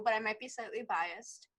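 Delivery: usual speaking voice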